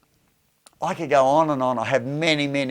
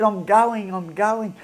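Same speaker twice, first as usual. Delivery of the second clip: higher, bubbling daughter's news; excited daughter; 8 semitones higher, slower than usual. Retold or imitated. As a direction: let the daughter's joy spill over. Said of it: "and all excited".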